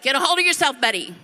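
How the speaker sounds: loud, high mock scolding; exasperated retort. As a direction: snap him out of it, loudly. Retold retort to angry husband.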